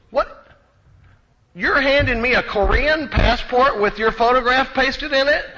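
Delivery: high, loud disbelieving outcry; shocked customs officer. Retold or imitated. Customs officer staring at a Korean passport, shocked. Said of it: "she goes".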